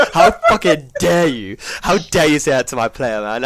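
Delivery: mock outrage. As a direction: drag it out, mocking the offended manager. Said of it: "he was like".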